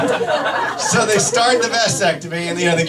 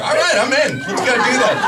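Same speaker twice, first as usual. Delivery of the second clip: eagerly agreeing; 4 semitones higher than usual. Retold or imitated. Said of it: "the energy's completely different".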